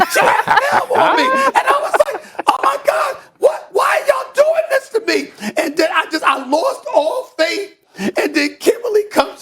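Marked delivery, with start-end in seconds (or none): 0.1-5.5 mock outrage. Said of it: "I'm just getting emotional right now".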